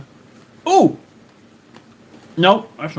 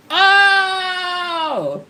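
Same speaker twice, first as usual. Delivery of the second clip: surprised shout; 11 semitones higher than usual. Real, not acted. Sudden exclaim at chat.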